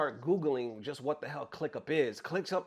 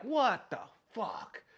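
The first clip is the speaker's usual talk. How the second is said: slow, drawn-out exasperation, raised pitch; mad at himself; 5 semitones higher, 4 dB quieter, much slower than usual. Retold or imitated. Victim cursing himself for clicking, retold exasperated anger. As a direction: stretch each word in self-directed disbelief. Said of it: "now I'm mad at myself".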